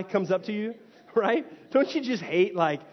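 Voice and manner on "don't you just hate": usual speaking voice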